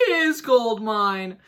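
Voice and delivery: loud, high, slow "His gold mine!"; excited discovery. Acted. Narrator thrilled as Chris finds his gold mine. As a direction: savor the discovery with a big thrill.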